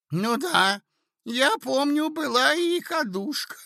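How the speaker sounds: put-on very high, piping old man; absent-minded mumbling prince. Prince dimly recalling an earlier conversation, absent-minded. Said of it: "пробормотал бессознательно князь".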